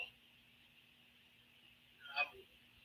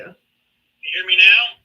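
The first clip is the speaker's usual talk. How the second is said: choppy breaking-up phone line; glitchy call audio; bad connection; 9 dB louder, much slower than usual. Real, not acted. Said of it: "you're breaking up really bad".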